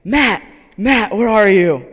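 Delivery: loud, high-pitched calling out; shouting. Retold or imitated. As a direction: imitate rowdy guys yelling for their friend. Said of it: "and they're saying".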